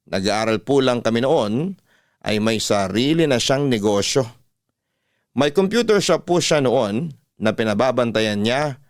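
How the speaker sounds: ordinary delivery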